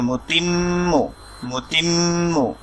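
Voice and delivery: মুতিম্মু with the doubled ম held through the nose, slowly; nasal hold on the doubled m. Shown as a demonstration. Teacher showing learners the nasal hold on মুতিম্মু. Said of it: "একটু নাকে ধরে আমরা সাউন্ডটা করছিলাম".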